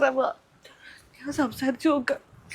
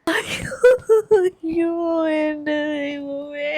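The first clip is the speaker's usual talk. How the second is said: slow breathy wail, voice cracking; keen out the grief in shock; much slower than usual. Acted. Mother hearing her son's bride is married, wailing. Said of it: "ആ കരച്ചിലാ നിർത്ത്".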